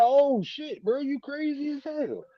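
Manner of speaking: high, stretched-out amazement; shocked disbelief. Retold or imitated. Reacting to the morphine news, high shocked disbelief. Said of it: "And I'm like".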